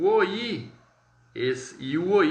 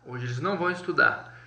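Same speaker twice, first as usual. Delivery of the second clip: ordinary talk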